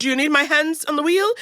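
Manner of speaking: imitating an over-compliant driver; retold deference. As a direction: ask politely, lifting at the end. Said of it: "You were like".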